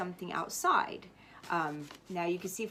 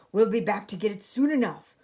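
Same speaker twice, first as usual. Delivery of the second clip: captain's promise; 9 dB louder than usual. A narrator's reading. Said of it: "Braidbeard handed me a flag and said".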